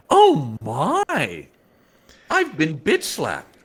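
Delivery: high, drawn-out mock shock; Princess Leia. Voicing a drawing of Leia holding her face, mock shock. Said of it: "the drawing of Leia holding her face like".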